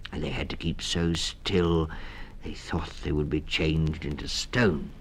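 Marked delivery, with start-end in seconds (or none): none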